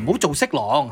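ordinary talk, nothing marked

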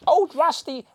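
very high, loud, squealing panic; panicked toy voice. Pretend. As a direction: shriek to get the blanket off. Toy begging blanket off.

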